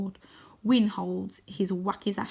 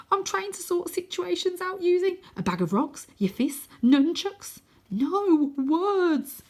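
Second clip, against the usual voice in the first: put-on high chirpy boy; 10 semitones higher, 5 dB louder, slower than usual. Wynn character voice, high.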